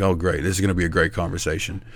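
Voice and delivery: quiet, resigned sarcastic aside; dry sarcasm. Retold or imitated. Bracing for a lecture, dry sarcastic aside. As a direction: grumble it softly to yourself. Sarcastic inner reaction to reply.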